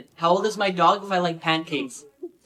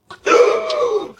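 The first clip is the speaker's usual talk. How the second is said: very high, loud gasp; shocked gasp; 5 dB louder than usual. Acted. Kid's shocked gasp at the mailman news.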